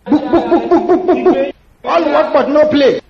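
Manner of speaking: high, loud mocking rant; loud mocking complaint; mocking scold. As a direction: scold him for always studying. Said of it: "She looked at me and said".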